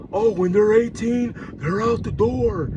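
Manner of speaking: lightly put-on dismissive voice of the ex; a hint of a careless mother; mocking the ex. Father mimicking his ex about kicking kids out at eighteen. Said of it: "She said".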